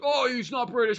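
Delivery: high, mocking whiny voice; sending up fans who complain, high and mocking; mocking complainer. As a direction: mimic a nitpicking fan. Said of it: "some people be like".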